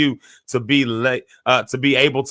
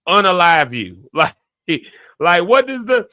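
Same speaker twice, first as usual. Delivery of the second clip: ordinary talk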